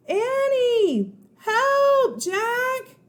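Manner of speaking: high, loud shout for help; high shouted cry for help. Acted. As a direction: scream from far away for help. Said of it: "came a cry".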